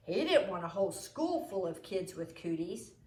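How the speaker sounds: ordinary delivery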